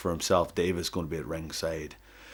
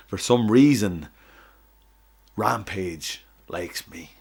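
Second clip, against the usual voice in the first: loud promo; 2 semitones higher, 9 dB louder, slower than usual. Acted. Wrestling promo speech.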